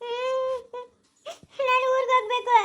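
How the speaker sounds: high, tearful plea; whimper, then wail to go home; sobbing in a high voice; crying. Acted. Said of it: "ಯಾಕೆ ಅಳ್ತಾ ಇರೋದು ನೀನು".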